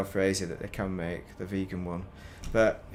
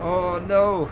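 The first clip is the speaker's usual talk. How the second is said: sudden high, loud cry of alarm; real dismay as it goes wrong; 7 semitones higher, 7 dB louder than usual. Real, not acted. Streamer reacting to his ship misfiring, alarmed.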